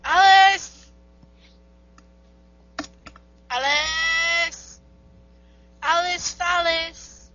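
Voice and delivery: high, drawn-out call. Real, not acted. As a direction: call a friend's name to get a response. Calling to friend on the call.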